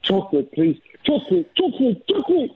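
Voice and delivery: high-pitched puppet begging for chocolate; Teddy. Acted. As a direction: plead as a one-track-minded puppet, voice climbing. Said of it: "Teddy, can you do".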